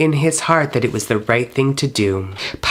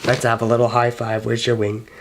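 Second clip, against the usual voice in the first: ordinary delivery, nothing marked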